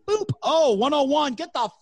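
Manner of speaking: a bouncer kicking someone out; club bouncer. Retold or imitated. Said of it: "They walk up and they go".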